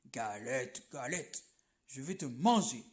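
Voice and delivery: bear's big voice. Acted. Bear to the galette, deep growling threat. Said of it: "grogne l'ours de sa grosse voix".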